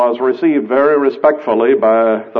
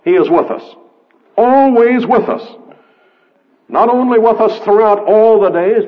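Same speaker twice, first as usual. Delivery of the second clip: preaching; 7 semitones higher than usual. Acted. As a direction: proclaim it to the congregation. Preaching at full force, raised voice.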